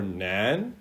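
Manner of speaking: nan rising in the second tone. Shown as a demonstration. Teacher demonstrating the second tone, rising nan. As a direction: lift nan upward like a question. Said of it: "that's the second tone".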